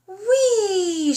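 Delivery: high, loud squeal of joy; gleeful whee. Acted. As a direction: jump off with delight. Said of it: "she squealed".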